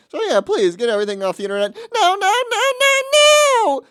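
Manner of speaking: climbing to a high, loud mock scream; hammed-up shrieking imitation; imitating screaming. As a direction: replay the original outburst, escalating. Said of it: "him screaming".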